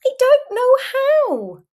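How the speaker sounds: put-on very high, loud little-kid whine; squeaky, helpless bedtime protest; bedtime child's complaint. A narrator's reading. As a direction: wail it like an overtired child. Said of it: "you mumble".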